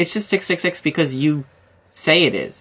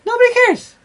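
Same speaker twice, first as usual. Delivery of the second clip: much higher, cartoonish squeak; squeaky mock voice; 5 dB louder than usual. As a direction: pitch it way up, mocking. Repeating the punchline, mocking.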